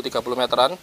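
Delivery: ordinary delivery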